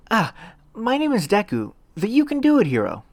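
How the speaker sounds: put-on eager young hero voice; proud boy hero. A narrator's reading. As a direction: announce your hero name proudly. Introduces himself as Deku.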